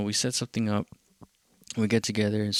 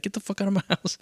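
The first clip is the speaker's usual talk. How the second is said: mock angry outburst, pitched much higher; hammed-up pitched-up yell of a riled host; imagined angry host; 9 semitones higher, faster than usual. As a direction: play the mad gatekeeper, half joking. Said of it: "is he gonna get mad and be like".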